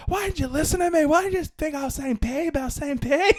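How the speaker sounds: high-pitched Scottish tirade; hammed-up mad. Furious Scottish customer berates shop worker, mock angry rant. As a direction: rant shrilly as an outraged Scottish lady. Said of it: "And she got so mad".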